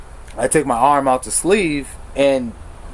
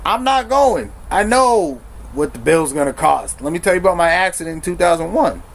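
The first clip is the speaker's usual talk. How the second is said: defiant retort; 6 semitones higher than usual. Retold or imitated. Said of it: "I'm like".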